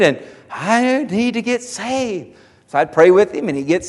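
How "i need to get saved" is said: a lightly voiced penitent man, higher and slow; imitating the man. Man at the altar asking the preacher, mildly pleading.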